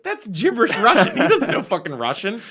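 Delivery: high, loud, amused exclamation; laughing assistant director. Retold or imitated. Assistant director catching the fake Russian, laughing. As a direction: call it out through laughter, imitating him. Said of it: "He starts laughing. He was like".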